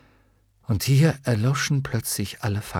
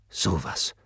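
low-voiced, amazed old warrior; astonished knight; 5 semitones lower, 4 dB quieter than usual. A narrator's reading. Hykryon marvels at the shattered armour, low astonished voice. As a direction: say it in a low character voice.

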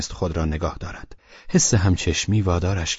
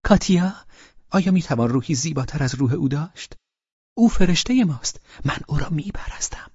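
high, delighted girlish voicing; happily; 5 semitones higher than usual. A narrator's reading. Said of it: "نادیا با خوشحالی گفت". (با خوشحالی و ذوق)

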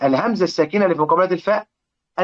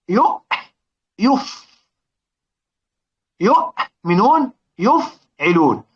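drawn out slowly, word by word, against its pattern; slow weighing demo; 4 semitones higher, much slower than usual. Shown as a demonstration. Teacher weighing a Quran word for students, slow and deliberate. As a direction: stretch each word to match it with its pattern. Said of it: "لو حبينا كلمة يؤمنون نوزنها".

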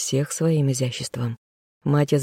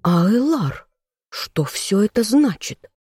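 high, sharp demand of an outraged woman; indignant sister; 6 semitones higher, slower than usual. A narrator's reading. Indignant sister demanding answers from her brother the king. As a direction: spit out the question in outrage. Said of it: "обернулась возмущённая женщина к брату".

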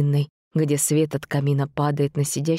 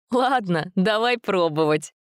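put-on laughing girl voice; 5 semitones higher, slower than usual. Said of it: "расхохоталась Даша".

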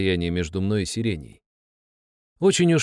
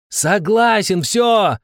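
much higher, loud desperate roar; 11 semitones higher, 5 dB louder, much slower than usual. Acted. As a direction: roar in surrender. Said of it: "взревел я".

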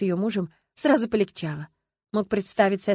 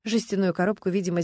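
plain reading voice

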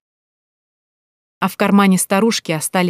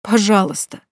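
pleading; 3 semitones higher than usual. Acted. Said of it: "взмолилась я".

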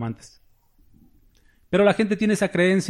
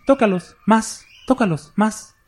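a lighter echo of the shouting preacher; slow, mocking preacher's command to touch them; mock decreeing preacher; 6 semitones higher, slower than usual. Mocking the preacher who says this, a slow half-shouted command. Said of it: "un predicador que dice así".

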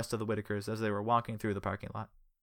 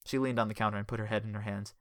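plain reading voice

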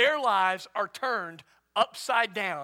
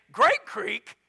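louder, incredulous small-town put-down; mock disbelief; 6 dB louder than usual. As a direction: question the town name as if appalled. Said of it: "it'd be like today saying".

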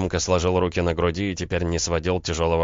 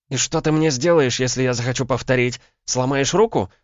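high, raised, biting female challenge; caustic; 8 semitones higher, 5 dB louder than usual. A narrator's reading. Woman daring man after slapping him, caustic. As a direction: taunt him with sharp questions, pitched up. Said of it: "едко поинтересовалась Вишневецкая".

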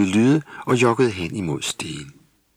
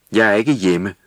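louder, sulky mock anger; angry reply; 7 dB louder than usual. A narrator's reading. Hiding gnome answers crossly from the hay. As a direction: snap it back from hiding. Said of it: "lød der et vredt svar".

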